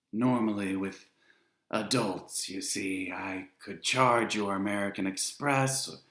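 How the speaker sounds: enjoy turning them away; Charon, smug refusal. A narrator's reading. Ferryman refusing dead children passage, silky and smug. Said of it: "He had a strange sound to him".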